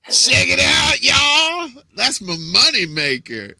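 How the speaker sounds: loud, high showoff shout; voicing the cat showing off, loud brag; hammed-up cat voice. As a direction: strut and holler it. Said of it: "She's like".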